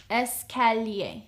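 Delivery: Escalier, stretched and careful; slow teaching echo of Escalier. Shown as a demonstration. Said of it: "How would you say stairs?".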